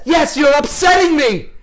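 high, loud acted outburst; upset shouting. Shown as a demonstration. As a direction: yell it back at full pitch. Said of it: "it always would start out something like this".

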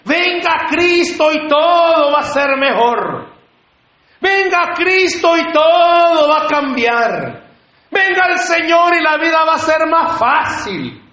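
high, slow, stretched rally cry; mock crowd-pulling preacher. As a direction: proclaim it like a stadium evangelist, stretching key words. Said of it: "como mucha gente lo quiere propagar".

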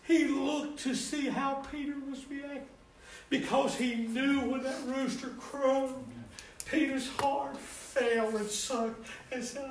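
high, loud, fervent preaching cadence; impassioned preaching. Acted. Preacher to congregation, high loud fervent preaching. Preacher rising to full force.

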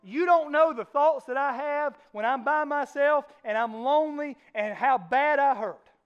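slow, loud, pleading "Pastor"; a congregant complaining to the pastor, loud and slow; hurting congregant. Retold or imitated. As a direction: voice a struggling churchgoer crying out. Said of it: "some of you will say this morning".

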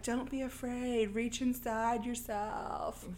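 soft, slow, reassuring mom voice; imitated soothing mother. Mom's voice speaks to Sarah from picture, slow imitated soothing. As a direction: draw it out gently like a ghostly mother. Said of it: "we hear her mom's voice like".

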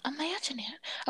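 hushed silly character voice; put-on whispery voice. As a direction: whisper it playfully, way down in volume. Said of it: "I'm gonna stop talking like that".

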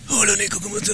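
a breathy, airless greeting; breathless. Retold or imitated. Mocking a friend who is breathless from balloons, airless. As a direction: say it with no air left.

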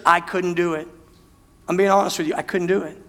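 ordinary delivery

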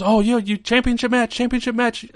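put-on very high, excited Japanese-accented squeal; mock high Japanese-accented title demand; Asuka impression. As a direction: bounce with hyped challenge energy.

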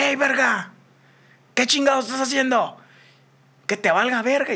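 high, forceful retold yell; retold shout. Confronting the man grabbing the girl, high shouted challenge. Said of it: "le grité".

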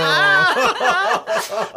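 very high, loud comic wail; hammed-up cry of dismay; mock wail. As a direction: wail in exaggerated hurt. Mock wail at classmate's blunt verdict.

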